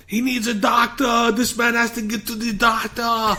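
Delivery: high, slow hammed-up yell; mock yelling. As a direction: call out for a doctor over the crowd. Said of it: "So he starts yelling".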